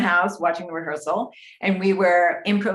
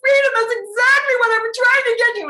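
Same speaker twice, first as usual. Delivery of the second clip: very high, loud, ecstatic director; John Michael; 6 dB louder than usual. Retold or imitated. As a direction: gush like a thrilled director praising a breakthrough. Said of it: "And then John Michael went".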